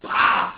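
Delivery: loud, abrupt body-check noise; explosive "bah"; imitated impact shout. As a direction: burst it out like a slam.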